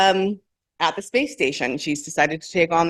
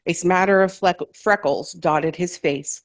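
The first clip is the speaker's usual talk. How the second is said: usual speaking voice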